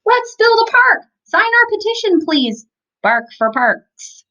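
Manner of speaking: high, loud, eager rallying cry; rally the town like a kid with a plan; a child calling on the town to sign; excited campaigning. Acted. Mother voices Sophia campaigning.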